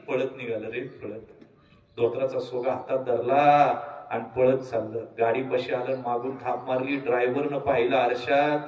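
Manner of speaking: ordinary talk, nothing marked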